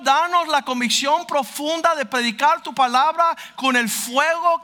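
loud, high, impassioned preaching; full-force pulpit preaching; fiery preaching. Acted. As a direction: preach it hard, pleading with the crowd.

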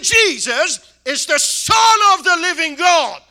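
loud, high, slow preacher shout; shouted proclamation. Retold or imitated. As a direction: proclaim it over the hall. Proclaiming to the crowd.

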